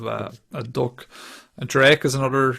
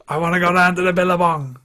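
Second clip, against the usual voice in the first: a louder, higher put-on Australian accent; 6 semitones higher, 5 dB louder than usual. Podcaster trying an Australian accent for the son, louder and higher. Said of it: "harder to do an Australian accent".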